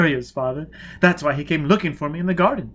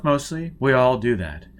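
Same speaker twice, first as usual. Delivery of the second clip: plain reading voice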